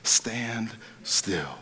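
whispered, slow and hushed; calm whisper. Retold or imitated. Moses telling the frightened people, slow hushed whisper. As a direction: breathe it like a quiet reassurance. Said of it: "he says".

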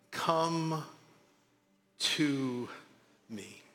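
slow, soft and fading "Come to me"; read slowly. Shown as a demonstration. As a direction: read each word apart, gently. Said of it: "I'm gonna read it slow".

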